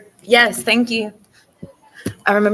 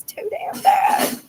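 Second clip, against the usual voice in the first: 1 grandpa voice; much slower than usual. Retold or imitated. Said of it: "the grandpa says".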